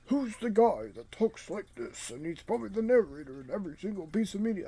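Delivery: put-on movie narrator voice; famous-narrator impression. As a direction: do a playful impression of a ubiquitous narrator. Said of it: "that's how I picture him sounding like".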